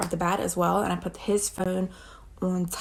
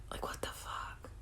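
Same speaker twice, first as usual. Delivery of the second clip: far softer, unvoiced whisper; whispered disbelief; 15 dB quieter than usual. Real, not acted. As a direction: breathe it out in disbelief. Stunned aside.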